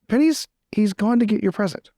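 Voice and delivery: normal talking voice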